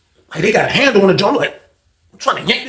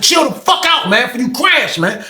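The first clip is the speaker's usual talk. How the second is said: high shouted warning; urgent yell telling a friend to stop; retold shout; 7 semitones higher, slower than usual. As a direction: bark at him to calm down. Yelling at friend to calm down.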